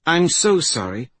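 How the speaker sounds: high, loud, upset apology; distressed girl's apology. Acted. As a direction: apologise urgently as the young heroine. Girl apologises to dying woman.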